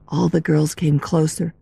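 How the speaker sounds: plain reading voice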